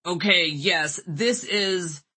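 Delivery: weird robot voice. Acted. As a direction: fake man's voice gone robotic. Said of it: "I put on the weird robot voice again".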